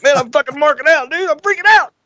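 gushing like a fan who can't contain himself backstage; retold excited freakout. Friend losing it backstage at Impact, giddy high excitement. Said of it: "He was like".

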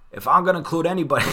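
quick, high, loud bad Boston accent; crappy Dave Gettleman accent. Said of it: "I'll do my crappy Dave Gettleman accent".

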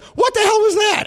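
high, loud startled yell as the wife; wife jolted by a spat laugh, shouting in shock. Retold or imitated. Wife reacts to being spat on, a high loud startled shout. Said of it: "she's like".

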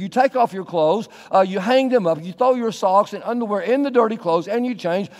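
ordinary talk, nothing marked

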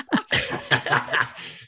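breathy unvoiced giggle trailing down; giddy laugh. Real, not acted. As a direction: let the laugh fall away. Laughing at own fanboying.

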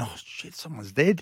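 muttered under the breath, mock dread; retold hushed alarm. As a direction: let the oath slip out almost voiceless. Dreading bad news on phone.

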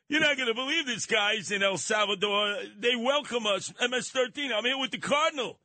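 high mock excited selfie message; boasting gang member. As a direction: brag to the guys back home, pitched high. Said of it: "sending it back saying".

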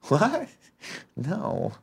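high squeaked question, then soft no; Andy. Acted. Doing best impression of co-host, high then soft. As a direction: squeak the question, drop quiet.

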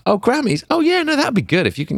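high, airy fake nonchalance; mock casual shrug; faking casual. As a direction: act like you barely care, but too eager. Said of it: "tried to be cool".

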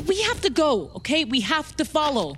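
high, urgent insistence; urgent insisting. Acted. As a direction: stress the have to, press on. Argument, urging to move on.

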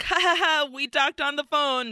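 imitated nervous over-cheeriness; awkward fake laugh. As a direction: cover embarrassment with a stiff laugh. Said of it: "horribly embarrassed and, like, awkward".

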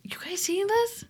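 breathy high whisper. Retold or imitated. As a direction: whisper it in awe. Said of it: "I'm like".

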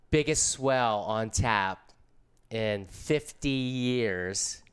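each word stretched slowly for effect; slow, dramatic build-up of the big news; drawn-out hype. Retold or imitated. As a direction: savour the words, very slow. Hyping the trip to his boss.

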